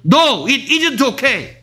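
high-pitched, hammed-up refusal; blunt refusal. Shown as a demonstration. Teacher shows how not to refuse, hammed-up blunt refusal. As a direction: exaggerate a rude no, pitch leaping. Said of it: "이렇게 거절하진 않겠죠".

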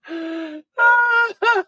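shrieking laugh well above her voice; laughing at being fooled; high squealing laugh. Real, not acted. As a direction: let a high laugh burst out. Laughing hard at herself.

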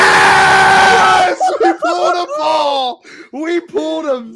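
huge high victory yell, drawn out; ecstatic shout. Real, not acted. Collector finally pulling the Paul card, ecstatic shout. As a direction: scream in triumph, high and stretched.

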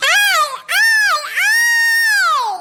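high, drawn-out yell; shrill shout. Acted. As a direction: call out sharply, stretched. Yelling at old woman.

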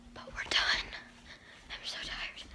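soft unvoiced whisper to the camera; hushed vlog whisper. Real, not acted. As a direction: whisper so no one nearby hears. Whispering while out shopping.

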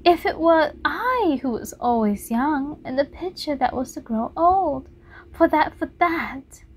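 put-on young Dorian pleading. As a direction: beg for eternal youth.

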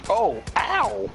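high-pitched ow; pained yelp. Real, not acted. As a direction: yelp at a sudden hit. Gets shot in the game.

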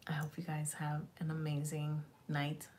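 soft unvoiced whisper; hushed goodnight to the viewers. Real, not acted. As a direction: whisper it like tucking them in.

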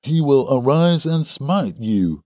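high, slow, pious threat; crying out. A narrator's reading. Second suitor calls down God's wrath, crying out. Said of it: "cried young Drebber".

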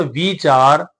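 slowly drawn vee-chaar for ਵਿਚਾਰ; long "ee" in ਵਿਚਾਰ. Shown as a demonstration. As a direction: lengthen the first vowel of ਵਿਚਾਰ. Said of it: "ਬਿਹਾਰੀ ਲਗਾ ਕੇ". (ਵਿਚਾਰ ਨੂੰ ਬਿਹਾਰੀ ਲਗਾ ਕੇ)

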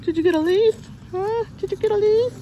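high, teasing puppy-talk question. Real, not acted.

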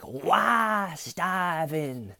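very high, stretched-out cry of despair; mountaintop mock wail. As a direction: wail to the heavens from a mountaintop, hammed up. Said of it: "the top of a mountain just being like".

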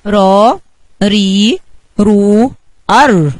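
separate, held "را، ري، رو، ار": raa, rii, ruu, arr; letter sound drill. Shown as a demonstration. Children's lesson repeat, each of "را، ري، رو، ار" drawn out. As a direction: sound out each r syllable with pauses between. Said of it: "نراجع الاصوات تاني مع بعض".

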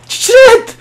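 high, loud yelp; startled shout. Real, not acted. Gamer losing a shotgun fight, startled shout. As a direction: burst out with it in dismay.

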